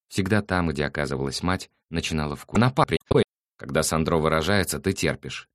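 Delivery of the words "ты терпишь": usual speaking voice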